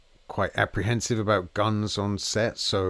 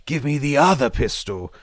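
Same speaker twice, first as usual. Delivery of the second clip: sharp, higher, louder order; urgent command; 7 semitones higher, 8 dB louder than usual. Retold or imitated. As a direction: bark it at the props guy. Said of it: "said to the props guy".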